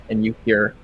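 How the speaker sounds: ordinary talk, nothing marked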